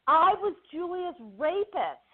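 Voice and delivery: high-pitched, eager retelling of his announcement; retold excited reminder. Actor reminding a co-star in the checkout line, excited. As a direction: imitate an eager, public reminder. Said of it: "this is being said out loud".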